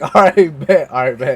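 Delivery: loud, breaking into laughter; laughing acceptance. Real, not acted. Worker told to have a seat, laughing acceptance. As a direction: say it while cracking up.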